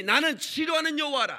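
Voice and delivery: high, loud declaration of God's word; booming proclamation. Acted. Preacher booming God's words to the congregation. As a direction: proclaim it like God speaking.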